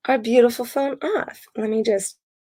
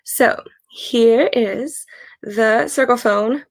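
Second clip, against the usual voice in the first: usual speaking voice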